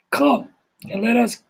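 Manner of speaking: ordinary talk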